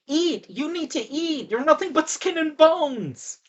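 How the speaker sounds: high, hammed-up Italian old lady; mock Italian grandma urging food. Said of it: "kinda stereotypical Italian, you've got the old lady".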